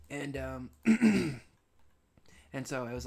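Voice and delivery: ordinary delivery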